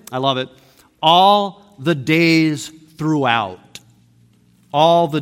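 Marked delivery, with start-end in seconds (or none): 1.0-3.8 "All the days throughout" very slow, each word weighted; slow emphatic reading. Shown as a demonstration. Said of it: "You want to hear the translation".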